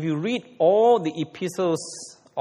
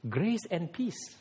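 gentle greeting; 9 dB quieter, slower than usual. Retold or imitated. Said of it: "What did he greet?".

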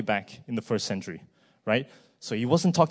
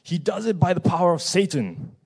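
higher, louder mock accusation; scoffing accusation; 6 semitones higher, 5 dB louder than usual. Preacher voices Pharisees scoffing at Jesus, scornful accusation. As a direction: fling it out like an indignant accuser. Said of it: "That was what the Pharisees were scoffing".

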